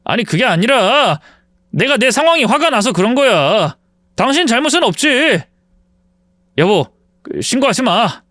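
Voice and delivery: mild, placating excuse; apologetic backpedalling. Acted. Ex-husband begging ex-wife not to report him, apologetic. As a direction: backtrack and plead with her. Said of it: "사과하더군요".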